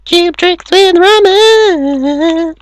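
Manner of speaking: squeaky, slow cartoon voice; high silly voice.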